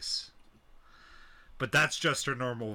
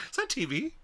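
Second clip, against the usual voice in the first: mock disbelief; 11 semitones higher than usual. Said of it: "I'm like".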